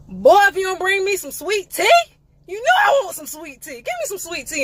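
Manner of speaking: loud and high, hammed-up indignation; mock outraged shout. Demanding sweet tea from the waiter, mock outraged shout. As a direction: yell it like he should have known.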